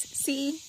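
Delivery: "See?" lifted high as a question; questioning inflection. Shown as a demonstration. Parent showing child where the block goes, questioning. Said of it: "So it's a question".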